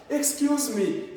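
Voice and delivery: high, slow excuse me; polite excuse me. Shown as a demonstration. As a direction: ask politely for attention. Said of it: "C'est poli même".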